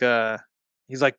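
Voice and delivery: ordinary talk, nothing marked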